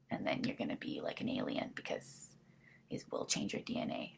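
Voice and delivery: breathy unvoiced whisper, very soft. Real, not acted. Whispering a vaccine warning to her viewers. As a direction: drop to a secret hush about the DNA.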